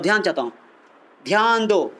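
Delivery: normal talking voice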